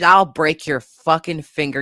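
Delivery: normal talking voice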